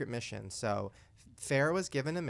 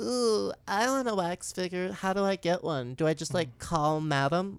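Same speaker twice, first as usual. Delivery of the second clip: slow, pitched-up clueless wondering; put-on high, naive voice; Farrah; 4 semitones higher, slower than usual. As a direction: imitate an airhead musing aloud. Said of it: "And then Farrah goes".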